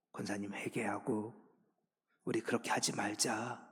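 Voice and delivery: gentle, quiet rebuke between believers; quiet gentle counsel. Retold or imitated. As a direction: speak low, as to a church elder alone. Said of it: "조용히 가서".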